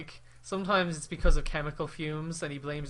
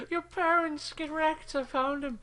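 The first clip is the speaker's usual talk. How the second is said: high, slow loud villain; hammy villain voice; 13 semitones higher, 3 dB louder, slower than usual. Retold or imitated. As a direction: gloat loudly and slowly. Impersonating Zod.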